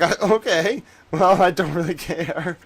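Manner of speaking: high, loud dismissive retort; mock indifference. Streamer answers mom's rejection, mock indifference. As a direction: protest too loudly that it is nothing.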